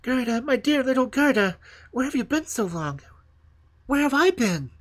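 put-on high, loud boy's cry. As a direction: cry out her name, overwhelmed. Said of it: "cried out".

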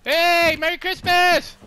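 high, loud holiday call across the map; loud friendly hail. Real, not acted. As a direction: shout a cheery greeting to a distant stranger. Calling out to enemy player.

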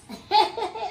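high, loud gleeful laughter. Acted. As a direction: laugh out your delight. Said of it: "Then why are you laughing".

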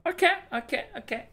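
squeaky high nervous okays; catching breath after a scare; panicking gamer. Real, not acted. Still shaken after jumpscare.

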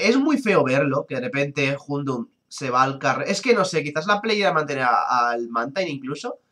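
ordinary delivery

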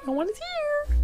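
very high squeaky voice; squeaky fake voice. Faking a high voice.

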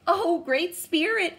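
high, loud, slow howl. A narrator's reading. Dog howls in fear at the ghost. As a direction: howl it to the spirit. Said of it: "he howled".

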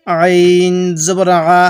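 long "আইন" squeezed lightly in the throat, then "আ"; light throat pressure. Shown as a demonstration. Teacher shows learners a correct ain, throat-pressed "আইন জবর আ". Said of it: "গলার মধ্যে হালকা চাপ দিলে".

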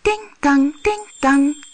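high ringing ting tang; ringing little bell; bell sounds. A narrator's reading. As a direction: chime it like a tiny bell.

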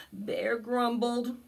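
ordinary delivery

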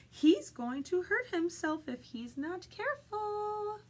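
high-pitched sheep voice climbing to the end; sheep's worried thought. Acted. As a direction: fret up high as the sheep, stretching the last word.

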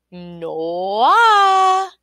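long, loud, swelling cry; drawn-out refusal. A narrator's reading. Little man rejecting the queen's guess, drawn-out cry. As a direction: stretch the word and let it swell.

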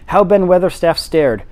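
ordinary delivery, nothing marked

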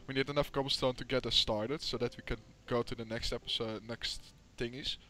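ordinary talk, nothing marked

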